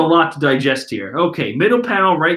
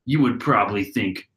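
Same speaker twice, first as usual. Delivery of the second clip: deep Katakuri voice; 8 semitones lower than usual. Retold or imitated. Katakuri's deep voice used by mistake for Brulee's line. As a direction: rumble it like the big brother. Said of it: "That's Brulee talking".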